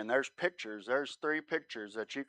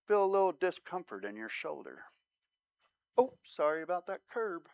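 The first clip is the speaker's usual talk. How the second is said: mock sorry; 6 semitones higher, 3 dB quieter than usual. Mock apology while hitting potholes.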